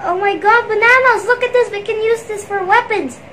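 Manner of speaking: loud, high excited shout; thrilled discovery, shouted. Acted. Excited to find bananas.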